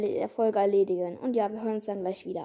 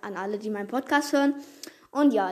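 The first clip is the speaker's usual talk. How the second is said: normal talking voice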